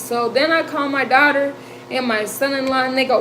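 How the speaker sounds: ordinary talk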